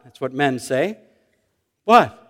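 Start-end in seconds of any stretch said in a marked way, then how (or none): 1.8-2.2 loud, high, defensive question; indignant husband. Acted. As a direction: act the innocent man challenged. Husband's indignant reply, repeated.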